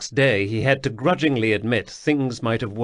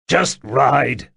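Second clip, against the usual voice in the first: louder curt snap; snapped; 2 semitones higher, 6 dB louder than usual. Acted. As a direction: cut him off short. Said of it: "Sul snapped".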